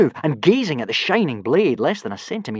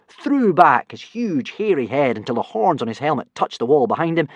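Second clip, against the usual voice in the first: ordinary delivery, nothing marked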